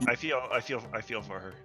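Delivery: ordinary delivery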